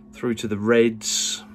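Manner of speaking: normal talking voice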